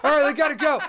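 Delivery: high, loud yell with a laugh in it; hammed-up urgent call; mock frantic shouting. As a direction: shout it high over the storm. Acting out frantic sailor's shouts.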